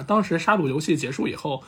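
ordinary talk, nothing marked

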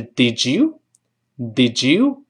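"Did you" run together as "di-dju"; drawn-out "Did you", d and y merged, rising; linked "did you". Shown as a demonstration. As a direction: blend "Did you" into a j sound, slowly. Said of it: "连读变成之".

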